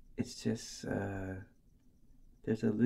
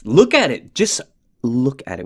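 excited; 9 dB louder than usual. Real, not acted. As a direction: blurt it out, thrilled. Excited about the strap.

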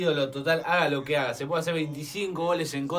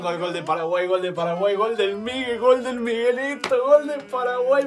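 goal commentary; 7 semitones higher than usual. Real, not acted.

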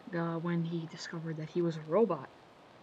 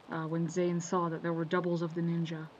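ordinary delivery

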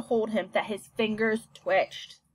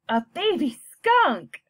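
slow, awed exclamation; delighted gasp; 5 semitones higher, 5 dB louder, slower than usual. Acted. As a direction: marvel at it slowly. Child delighted by kit.

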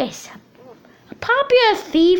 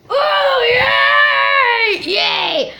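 excited cheering; 9 semitones higher, much slower than usual. Pretend. Contestant cheers entering the stage.

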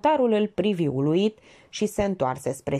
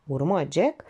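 ordinary delivery

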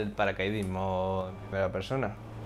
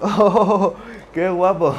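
loud, high thrilled cry; excited exclamation; 8 semitones higher, 9 dB louder, much slower than usual. Real, not acted. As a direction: burst out in delight. Impressed by the jump view.

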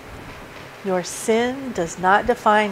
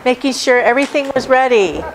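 building preaching; 4 semitones higher, 4 dB louder than usual. Acted. Preaching builds up Martha's busyness.

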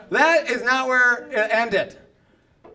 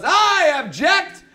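slow, loud and higher, announcing it; drawn-out declaration; 5 semitones higher, 5 dB louder, much slower than usual. Retold or imitated. Objector interrupting the minister, slow loud proclamation. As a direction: stretch out the big objection. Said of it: "a guy stands up and goes".

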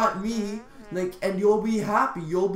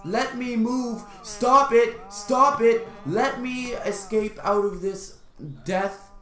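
raised, urgent plea to be let go; higher-pitched, insistent begging to escape; frantic pleading; 5 semitones higher, slower than usual. Real, not acted. Player trapped by the mob in game, frantic pleading.

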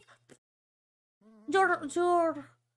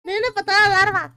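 louder, playful order to kick Larva; loud teasing shout; loud call; 3 semitones higher, 8 dB louder, faster than usual. Real, not acted. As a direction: call it out across the room, laughing.

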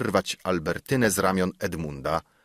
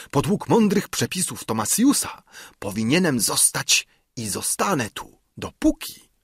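higher, insistent self-justification; obstinate secretary; 6 semitones higher than usual. A narrator's reading. Secretary cites his rules and refuses to go, insistent. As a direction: dig in, voice climbing at the end.

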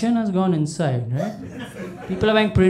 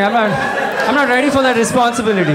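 fast, laughing through the words; 4 semitones higher, faster than usual. Real, not acted. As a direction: rush it out while laughing. Laughing at own joke.

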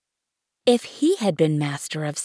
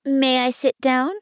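put-on higher-pitched, timid child character; timid inquiry; 7 semitones higher, 4 dB louder than usual. A narrator's reading. Little prince asking the king for a seat, timid. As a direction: ask meekly, lifting the pitch. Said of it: "came now a timid inquiry".

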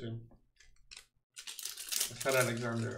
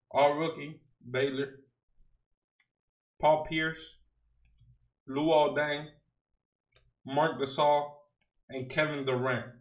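ordinary delivery

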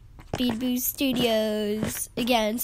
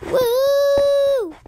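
high, loud, long "woo"; celebratory whoop; 13 semitones higher, 8 dB louder than usual. Real, not acted. Gamer testing her returned voice, celebratory whoop. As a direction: let out a ringing whoop.

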